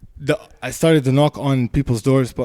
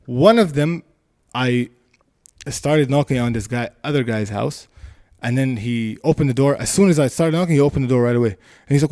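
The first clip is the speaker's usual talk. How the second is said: ordinary delivery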